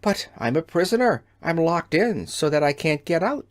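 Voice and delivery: ordinary delivery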